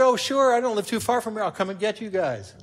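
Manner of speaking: a hint of a helpful old friend; imitating a cheerful woman. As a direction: offer help the way she did on the phone. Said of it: "And she said".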